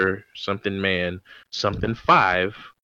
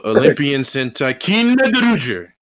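announcer voice; 7 semitones higher, 7 dB louder than usual. Acted. Announcing the show title.

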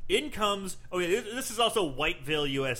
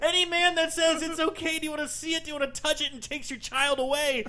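high and loud, laughing all through; laughing rant; 12 semitones higher, 4 dB louder than usual. Real, not acted. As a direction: rant while cracking up. Laughing rant about the scene.

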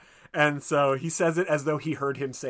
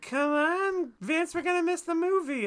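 very high put-on calling voice; imitating a date hurrying her man along; mock impatient date; 4 dB louder than usual. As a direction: call him away in a high-pitched mock plea. Mimics the impatient date calling him away.

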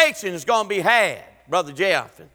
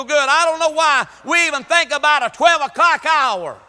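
full-force preaching; 3 semitones higher than usual. Acted. Preacher urging the church to keep worshipping, building. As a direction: pound each line harder.

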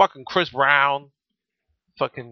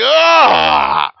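disgusted ugh; 11 semitones higher, 7 dB louder than usual. Retold or imitated. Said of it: "I was like".

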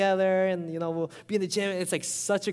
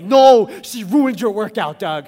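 much louder, higher, goading inner voice; hardened heart's voice; 5 semitones higher, 14 dB louder than usual. Retold or imitated. Pastor voicing his hardened heart goading him to fight. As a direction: egg him on like a spiteful inner voice. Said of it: "the hardened heart, is like".

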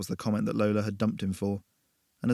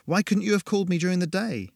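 exasperated; 8 semitones higher than usual. A narrator's reading. Irritated, scared at night.

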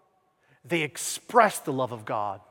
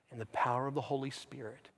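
ordinary talk

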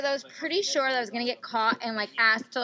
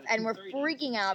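ordinary delivery

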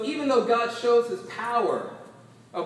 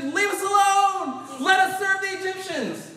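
louder, higher frightened cry of the people; 8 semitones higher, 4 dB louder than usual. Acted. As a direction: cry out in panic at Moses. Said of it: "They were terrified and cried out".

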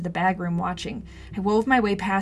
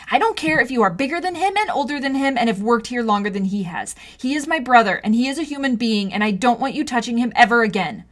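higher, loud furious lecture; angry; 4 semitones higher, 6 dB louder than usual. Acted. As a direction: jab every word at him. Confronting the bully.

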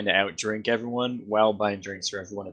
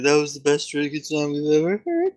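mock mournful patron; 4 semitones higher, 3 dB louder than usual. Innkeeper crowd voiced by GM.

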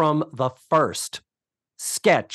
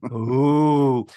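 long, drawn-out impressed ooh; mock awe; 4 dB louder than usual. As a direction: coo it in mock wonder. Mock small-town awe at New York.

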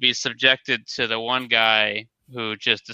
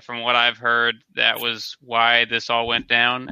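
ordinary talk

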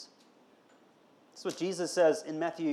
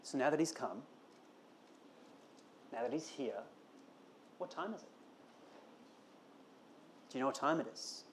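much softer, intimate questioning; hushed; 2 semitones lower, 14 dB quieter than usual. Acted. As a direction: lean in and ask quietly. Quiet reflective close of section.

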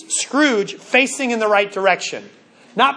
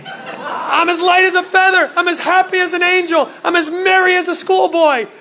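giddy Scrooge; 7 semitones higher than usual. Acted. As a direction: burst out like a man reborn, cackling with glee. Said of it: "I think you brought a little Bruce in there".

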